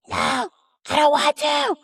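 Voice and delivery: put-on high-pitched whiny toddler scream; mock toddler meltdown yell. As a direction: imitate a kid refusing, loud and high. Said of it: "Like, screaming".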